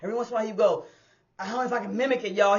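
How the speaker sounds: usual speaking voice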